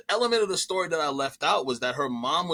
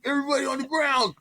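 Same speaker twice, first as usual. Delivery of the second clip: high, raised mock police command; mock cop raid shout; 11 semitones higher than usual. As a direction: bark it like a raiding cop, tongue in cheek. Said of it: "coming in like".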